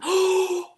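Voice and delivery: sharp high gasp of surprise; big shocked gasp; loud surprised gasp. Real, not acted. Surprise at new sponsor.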